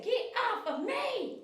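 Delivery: high, drawn-out mock protest; voicing the dog. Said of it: "You say".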